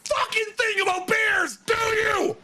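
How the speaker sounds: mock angry yell. Fred yelling at her about bears, imitated shouting rant. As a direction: belt it out like a boss chewing you out.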